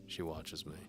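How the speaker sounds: ordinary delivery, nothing marked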